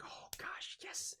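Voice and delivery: hushed, voiceless thrill; relishing whisper. Acted. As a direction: whisper it as if savouring it. Savours the stories that fire him up.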